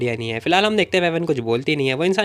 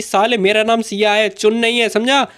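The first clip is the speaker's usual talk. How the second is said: mildly angry, higher-pitched retort; 5 semitones higher, slower than usual. A narrator's reading. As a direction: set him straight with some heat. Grabs him, angrily corrects his name. (हल्के गुस्से में ऊँची आवाज़)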